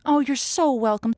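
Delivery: ordinary talk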